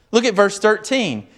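ordinary delivery

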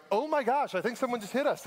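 high, startled gasp of alarm; startled wife. Retold or imitated. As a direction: play the wife jolted by a sudden bump. Wife startled by car bump.

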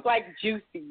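normal talking voice

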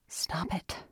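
soft breathy mutter to herself; under your breath, scolding yourself. Acted. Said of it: "she muttered".

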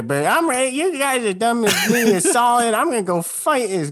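NPC cowboy voice. Acted. NPC Peaches ready to fight.

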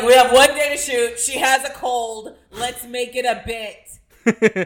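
loud, emphatic acted announcement; mock production decision. Filmmaker with one day to shoot, loudly declaring a plan. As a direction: belt it out, slowing on the last line.

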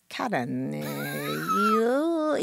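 light imitation of a Filipino accent, lilting up high; a teasing Filipino accent with a lilt. Mimicking the coach's Filipino accent for a laugh. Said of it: "he would just do this lilt".

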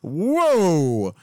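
drawn-out amazed whoa; stunned whoa. Retold or imitated. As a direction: act blown away, high. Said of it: "you'd be like".

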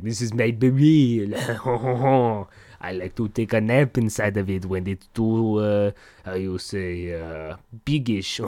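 hammed-up French accent, slowing as it goes. Frenchman showing off his giant beret, mock French accent. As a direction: play a cartoon Frenchman hunting for words. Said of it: "Frenchman outfit".

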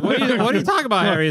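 high, fast disbelieving question; incredulous. Retold or imitated. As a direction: rattle it off in mock disbelief. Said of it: "I was like".

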